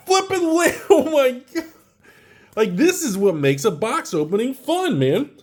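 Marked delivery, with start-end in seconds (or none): 0.1-1.6 breaks into giggles mid-sentence; laughing. Real, not acted. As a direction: laugh through the words. Laughing at another pull.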